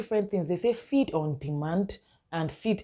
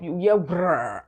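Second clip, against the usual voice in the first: slow, drawn-out burp sound "brrr"; rolling mock burp noise; imitated burp; slower than usual. Shown as a demonstration. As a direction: mimic the baby's burp. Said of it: "they'll burp".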